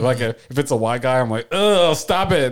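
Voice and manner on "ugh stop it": loud, high groan of disapproval; raised, fed-up mock scolding; acted exasperation. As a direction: act out cringing at someone's accent. Acted disgust at a fake accent.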